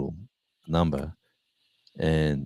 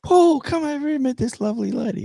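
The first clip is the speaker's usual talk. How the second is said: much higher, squeaky mock-sweet voice; mock falsetto introduction; 3 dB louder than usual. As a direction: sell the lady to Paul in a sing-song falsetto. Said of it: "I said".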